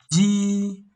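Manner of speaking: "Ji" held very high, level and loud; first tone, high. Shown as a demonstration. Teacher demonstrating first tone, "Ji" high and level. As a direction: sustain "Ji" on one high pitch. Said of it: "which is high pitch".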